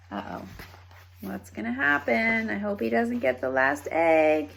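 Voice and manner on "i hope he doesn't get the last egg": usual speaking voice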